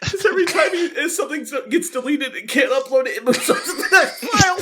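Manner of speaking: high, laughing through the explanation. Real, not acted. Explaining the bug to friends, laughing.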